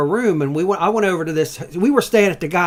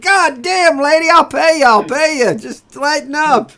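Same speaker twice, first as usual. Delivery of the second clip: much higher and louder, pleading and laughing through it; retold exasperated yell; 12 semitones higher, 8 dB louder than usual. Hungover guest pleading with broom-wielding lady, loud. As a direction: beg her to stop, half laughing.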